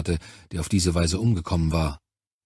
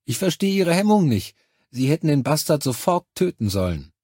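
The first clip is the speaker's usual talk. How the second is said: high-pitched, insistent reproach; reproachful; 7 semitones higher than usual. A narrator's reading. Charlie blaming the commander for hesitating, reproachful. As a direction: press the reproach in a much higher voice.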